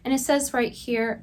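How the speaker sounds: ordinary talk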